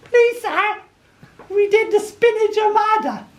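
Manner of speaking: very high, eager little schoolboy voice; nerdy kid. Retold or imitated. Nerd at the back answering the supply teacher, squeaky and eager. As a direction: pipe up like the keenest kid in class. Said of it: "little nerd at the back pipes up and goes".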